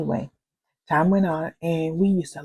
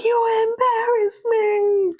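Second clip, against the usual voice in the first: slow, higher, tearful complaint; retold crying; 5 dB louder, much slower than usual. Wife telling her husband through tears he embarrassed her. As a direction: let the hurt pour out slowly. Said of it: "And I'm crying".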